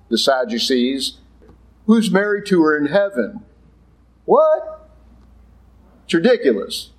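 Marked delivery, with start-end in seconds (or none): 4.2-4.9 high incredulous what; mock disbelief. Preacher mocking the Sadducees, incredulous. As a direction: squeak it out in disbelief. Sadducees' absurd question mocked.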